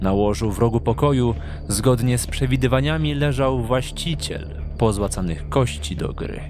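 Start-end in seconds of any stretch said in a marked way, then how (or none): none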